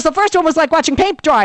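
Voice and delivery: a husband raving about the vice presidential debate, excited; thrilled husband. Retold or imitated. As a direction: gush like a fan after a great show. Husband mocking dull debate.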